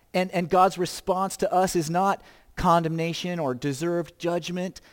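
normal talking voice

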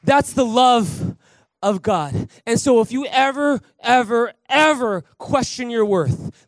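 loud, high, ringing preacher's call; hammed-up revival fervour, pitched high and loud; full-force preaching. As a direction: rouse the church to its feet.

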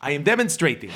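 ordinary talk

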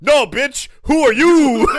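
loud, high hammed-up snarl thrown back; mock menacing yell. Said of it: "Zorro throws the machete and goes".

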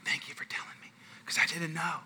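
soft, earnest whisper; retold whispered gratitude; hushed thanks. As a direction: whisper it, abashed. Retold hushed thanks from worship leader.

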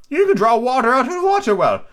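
high, loud, scornful explanation; condescending eccentric; Hatter character voice. Acted. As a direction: spell it out high and theatrical.

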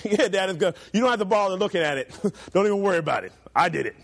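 chuckling fast brush-off; hurried, amused teen excuse; laughing dismissal. Retold or imitated. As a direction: wave dad away with a laugh. Teen self dodging dad check.

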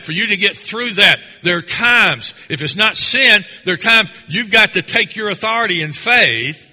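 loud, rising preacher's push toward a shout; fiery preaching. Acted. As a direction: build the congregation to a peak. Preaching climax.

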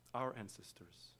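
ordinary talk, nothing marked

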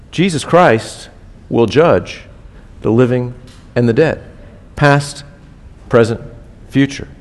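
each phrase set apart with long pauses; spaced emphasis. Acted. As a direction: drop the words in one by one, weighty. Pulpit emphasis, word by word.

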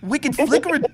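high-pitched fake Kiwi echo; mock Kiwi accent. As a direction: parrot it in a squeaky New Zealand accent. Echoes guest in mock Kiwi accent.

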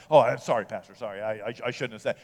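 low, trailing mock apology; imitated sheepish apology. Imitates man apologizing to pastor.

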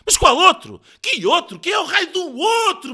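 high, loud angry yelling; furious shouted interrogation; exasperated bellow. A narrator's reading. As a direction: bellow the question in his ear. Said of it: "berrou ele aos ouvidos".

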